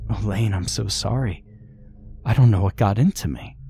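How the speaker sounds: plain reading voice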